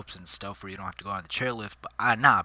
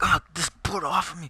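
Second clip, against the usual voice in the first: pained outburst; 7 semitones higher, 5 dB louder than usual. Retold or imitated. In pain, wrenching off bindings.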